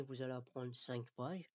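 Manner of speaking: normal talking voice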